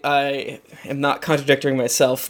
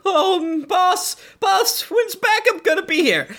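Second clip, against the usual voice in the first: whiny guard. Acted. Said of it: "it's like".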